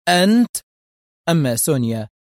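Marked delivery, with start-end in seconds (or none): 0.1-0.6 high, loud burst of surprise; sudden raised-voice recognition; startled exclamation. A narrator's reading. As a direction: exclaim it in shock. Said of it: "حتى هتف".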